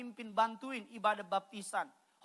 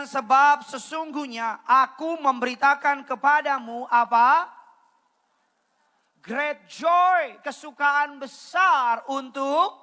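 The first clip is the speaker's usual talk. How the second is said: loud, high, slow scripture reading; stretched-out, booming verse call; loud proclamation; 4 semitones higher, 10 dB louder, slower than usual. Real, not acted. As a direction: declare the good news to a crowd. Proclaims verse, prompting congregation to join.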